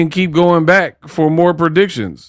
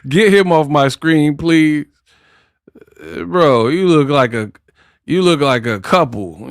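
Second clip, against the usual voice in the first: ordinary talk, nothing marked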